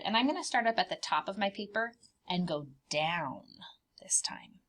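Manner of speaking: fast, breathy whispered plan; secretive whisper to young viewers; quick whisper. Acted. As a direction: rush it out in a whisper. Teacher confiding plan to kids.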